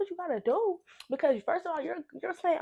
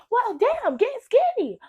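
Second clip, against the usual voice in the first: high, loud, mocking comeback; sassy retort; 11 semitones higher, 7 dB louder, slower than usual. Snapping back at the counselor, high and sassy. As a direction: toss it off with sass. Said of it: "And I was like".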